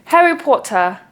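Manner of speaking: put-on British pronunciation; British accent. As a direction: repeat it in a mock British accent. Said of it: "do it in a British accent".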